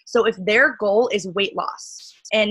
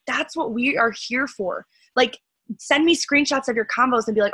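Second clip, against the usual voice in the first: ordinary talk, nothing marked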